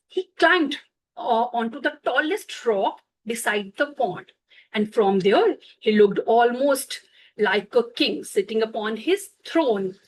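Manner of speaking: ordinary delivery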